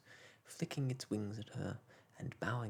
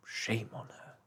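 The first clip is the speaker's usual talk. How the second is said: plain reading voice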